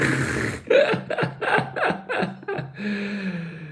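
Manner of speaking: a genuine, amused laugh at the question; real laughter. Real, not acted. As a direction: burst out laughing, quite high. Laughing at a chat question about wigs.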